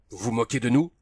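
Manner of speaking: usual speaking voice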